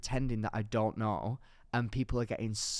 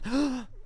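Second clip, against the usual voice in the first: sharp high gasp of fright; real shocked gasp as the chair gives; startled gasp; 9 semitones higher than usual. Real, not acted. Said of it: "I've never seen you look so scared".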